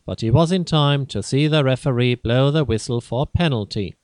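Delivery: plain reading voice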